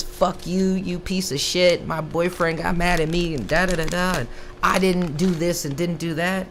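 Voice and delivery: imitating the Swedish girl cursing him out; mock angry ex. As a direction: play her telling him off, hammed-up. Angry ex-girlfriend voiced.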